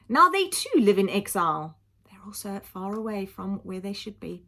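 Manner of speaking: plain reading voice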